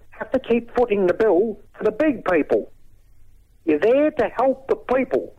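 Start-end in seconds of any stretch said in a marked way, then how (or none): 3.6-5.3 raised, impassioned pitch; indignant rant. Real, not acted.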